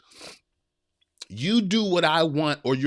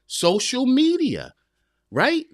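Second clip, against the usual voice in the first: social media said much higher and slow; emphatic stress; 10 semitones higher, 3 dB louder, slower than usual. Real, not acted. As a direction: lean hard on social media. Hammering home his point.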